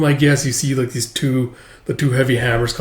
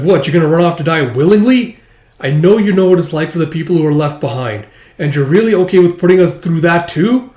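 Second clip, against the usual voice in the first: loud, high, upset pleading; heated pleading; 5 semitones higher, 6 dB louder than usual. Acted. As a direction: plead with her, voice raised. Alma pleads angrily.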